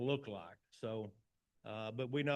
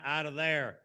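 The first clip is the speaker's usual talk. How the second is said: normal talking voice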